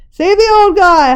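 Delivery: high, loud howl; a bystander howling to save the old man. A narrator's reading. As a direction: howl it over the crowd. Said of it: "howled someone".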